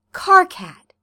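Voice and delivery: loud, high demand of his name. A narrator's reading. John demanding answers from crying Karkat, loud. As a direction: call his name hard, insisting. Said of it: "he demands".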